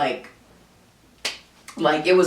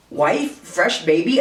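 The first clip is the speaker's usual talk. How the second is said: ordinary delivery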